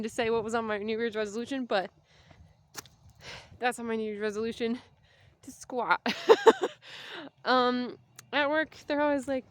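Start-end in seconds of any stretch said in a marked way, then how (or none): 6.0-7.4 bright laugh at herself; high laugh. Real, not acted.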